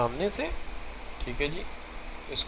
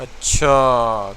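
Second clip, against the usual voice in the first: اچھا said low, slow and loud like a threat; a menacing, drawn-out اچھا, lower in pitch; threatening اچھا, as if squaring up to a rival; hostile; 5 semitones lower, 6 dB louder, much slower than usual. Shown as a demonstration. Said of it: "ایک دشمنی کا اچھا".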